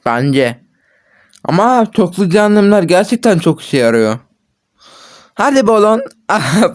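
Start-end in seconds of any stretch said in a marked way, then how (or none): none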